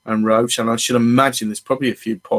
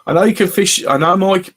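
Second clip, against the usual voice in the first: excited; 7 semitones higher, 5 dB louder than usual. Real, not acted. Excited reply to viewer.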